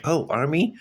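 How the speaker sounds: high, rising puzzled question; retold surprise. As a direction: act out being taken aback, pitch leaping up. Her puzzled reaction retold.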